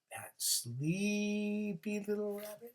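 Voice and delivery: sleepy. A narrator's reading. Sleepy story ending.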